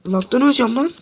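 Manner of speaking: frustrated shout. Real, not acted. Raging at lag in game.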